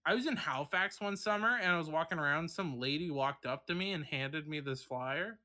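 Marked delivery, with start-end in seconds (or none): none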